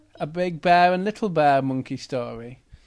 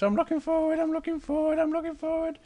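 high cub voice reciting to himself; Little Bear; 10 semitones higher, faster than usual. Acted. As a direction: chant it like a small bear trying to behave. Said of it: "As he turns his head backwards".

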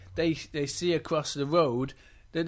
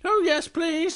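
Little Bear voice; 12 semitones higher, 5 dB louder than usual. Acted. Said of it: "says Little Bear".

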